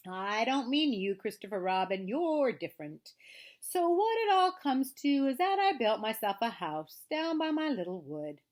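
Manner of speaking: plaintive donkey grumble with drawn-out words; long-suffering, self-pitying grumble; melancholy Eeyore. A narrator's reading. Reads Eeyore's lines.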